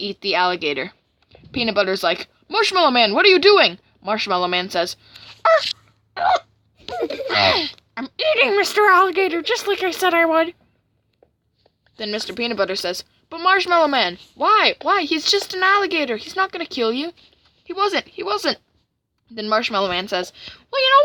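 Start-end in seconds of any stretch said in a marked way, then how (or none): 2.5-3.8 high, loud cartoon dismay; hammed-up shocked questioning; alarmed protest. Character confronts friend eating alligator. 6.2-10.5 high, loud put-on character voice; hammed-up gloating monster; defiant cartoon villain. 13.3-18.6 high-pitched cartoon friend, protesting; playful, distressed whine of protest; pleading friend.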